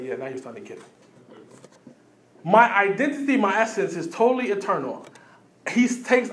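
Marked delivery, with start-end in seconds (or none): 0.0-0.8 much softer, lower knowing voice; hushed Jesus. Retold or imitated. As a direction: let them in on a secret.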